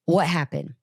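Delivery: ordinary delivery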